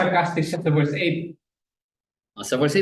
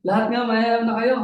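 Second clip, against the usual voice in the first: pitched-up mock congratulation; feigned awe ending on a rise; sarcastic; 7 semitones higher than usual. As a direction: imitate sarcastic flattery. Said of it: "yung kanyang sarcasm".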